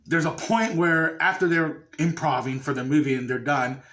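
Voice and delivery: ordinary delivery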